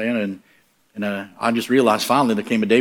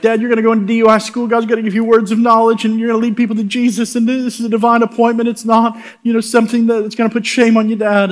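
put-on higher, louder, eager kid voice quoting his dad's future; imitating excited son; 10 semitones higher, 5 dB louder than usual. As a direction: gush it out like a thrilled teenage son. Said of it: "he's like".

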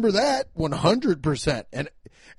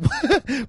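burst of laughter; 10 semitones higher, 3 dB louder than usual. Real, not acted. Laughs at own crazy idea.

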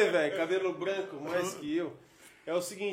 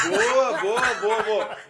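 loud, cracking-up reply; laughing through words; 2 semitones higher, 6 dB louder, much slower than usual. Real, not acted. Guest caught out by viewer's question, laughing loudly. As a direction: barely get it out for laughing.

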